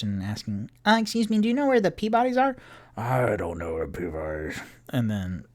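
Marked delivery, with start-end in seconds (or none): 0.8-2.6 high, fast questioning voice; asking around. Acted. A traveler asking strangers for directions, high and hurried. 3.0-4.6 low, soft dismissive stranger; gruff stranger. Acted. A passing local shrugging off the question, low and curt.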